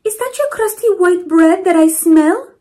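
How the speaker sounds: Mama Provi politely asking the neighbour; grandmother's voice. Acted. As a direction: ask sweetly like a kind grandma. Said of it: "Mama Provi asked".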